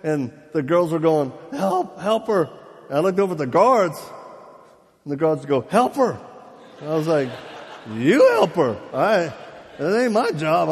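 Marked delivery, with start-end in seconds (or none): 1.5-2.5 high-pitched imitated cry for help; mock panicked plea. Girls cry out to guards. 5.6-6.2 high, mock-panicked command; imitated urgent order. Guards shout at him. 8.0-10.7 loud, comic protest; indignant retort. Retold or imitated. Retorts to the guards.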